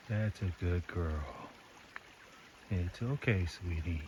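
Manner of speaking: soft, tender voice to an animal; soothing. Real, not acted. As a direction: gently coax a shy deer. Soothing a deer softly.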